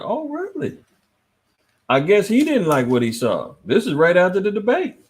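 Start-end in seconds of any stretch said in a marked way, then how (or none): 0.0-0.8 high, sarcastic disbelief; mock the headline with fake surprise; a sarcastic high-pitched oh really. Real, not acted. Mocking news headline.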